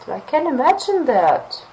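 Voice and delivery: high, lively sympathetic reply; engaged reaction. Shown as a demonstration. Teacher demoing an engaged listener's reply. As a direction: show you're really interested. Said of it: "some emotional reaction".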